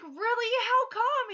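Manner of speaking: very high, loud curious questions; teacher teasing the birthday boy, high and amazed; delighted surprise. Retold or imitated. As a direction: ask in playful amazement. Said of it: "I was like".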